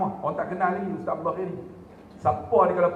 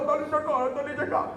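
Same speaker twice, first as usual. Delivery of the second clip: mock choked-up high voice; imitating tearful speech; 11 semitones higher than usual. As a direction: mimic someone too tearful to speak, pitched high. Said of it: "dia teriak".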